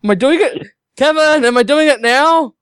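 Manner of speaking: high, puzzled phone voice; confused caller. Acted.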